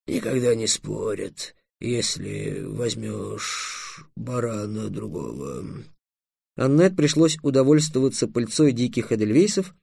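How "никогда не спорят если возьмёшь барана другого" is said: low, very slow, soft drawl; reassuring dragon. A narrator's reading. Dragon calming Trix about the taken sheep, soothingly. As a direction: soothe him, stretching the words lazily. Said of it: "успокоил Трикса дракон".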